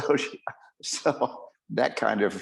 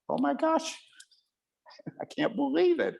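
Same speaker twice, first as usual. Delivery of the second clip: much higher, laughing in amazement; stunned disbelief. Retold or imitated. Doctor seeing his recovered patient walk in, laughing in shock. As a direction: gasp it out, delighted and floored by the recovery. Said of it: "And I just, like".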